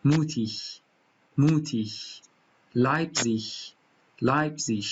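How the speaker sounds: both words said with a light ish at the end; standard German ending. Shown as a demonstration. Teacher repeats the standard ending for practice.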